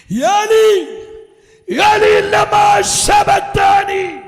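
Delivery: high, slow, wailing chanted cry; crucified Jesus crying out to God, high and drawn-out; cry from the cross. Acted. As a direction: stretch it into a long anguished lament.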